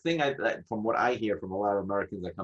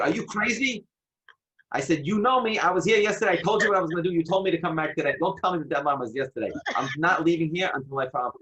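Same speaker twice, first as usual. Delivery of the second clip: loud, high indignant protest; angry; 8 semitones higher, 6 dB louder than usual. Retold or imitated. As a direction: raise your voice at the clerk, outraged. Angry confrontation with office clerk.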